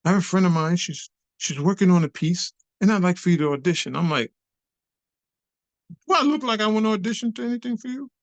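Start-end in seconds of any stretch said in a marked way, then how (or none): 0.0-3.9 higher, lightly acted polite offer; Frank. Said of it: "And Frank said". 6.1-8.1 high, fast incredulous comeback; indignant retort. Retold or imitated. Indignant reply to audition offer.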